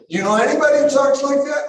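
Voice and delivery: high, loud, quick incredulous question. Real, not acted. Preacher asking listeners about Jesus's strange speech, incredulous. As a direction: challenge the room in disbelief.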